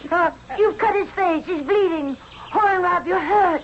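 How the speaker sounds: high, shocked cry; alarmed. Acted. Nefertiti sees Horenrab bleeding, high and alarmed. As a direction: gasp at the blood.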